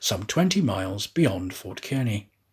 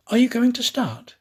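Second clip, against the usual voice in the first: high pleading question, raised voice; anxious Aouda; 10 semitones higher, 4 dB louder than usual. Acted. Aouda pleading with the conductor to start. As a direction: press him, worried.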